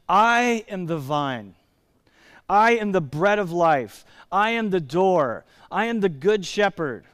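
slow, solemn proclamations with a weighty "I"; grand declaration. Acted. Preacher quoting Jesus describing himself, grand declaration. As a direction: stretch out each claim as a pronouncement. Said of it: "Who talks like that?".

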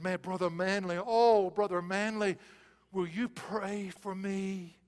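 slow, drawn-out plea from a sickbed; a dying woman asking for prayer; pleading. Retold or imitated. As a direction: beg him gently to pray. Suffering woman begs Manly.

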